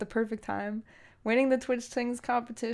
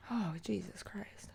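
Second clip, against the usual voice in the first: breathy dismayed whisper under the breath; real whispered dismay; 12 dB quieter than usual. Real, not acted. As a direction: whisper it in dread at the song pick. Dreading the next song.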